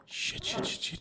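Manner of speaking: tense hushed whisper; whispering while hiding. Acted. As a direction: whisper it as he comes near. Boyfriend approaching, hiding.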